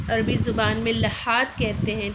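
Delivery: normal talking voice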